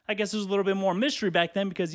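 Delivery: usual speaking voice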